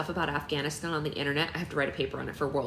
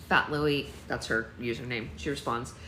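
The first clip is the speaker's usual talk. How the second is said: ordinary delivery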